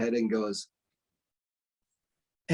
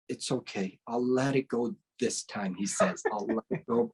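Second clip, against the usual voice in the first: soft, low mock-lenient master; lightly voiced lenient sifu; forgiving master; 5 semitones lower, 7 dB quieter than usual. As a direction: let it go gently, a touch amused. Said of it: "And then he says".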